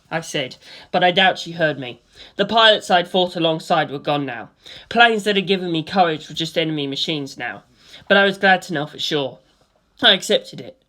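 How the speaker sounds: plain reading voice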